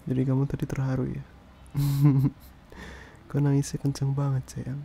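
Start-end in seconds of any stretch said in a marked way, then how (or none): 1.7-2.5 short, loud chuckle; fond chuckle. Acted. Boyfriend teasing partner over loud crying, fond chuckle. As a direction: chuckle lightly at their big cry.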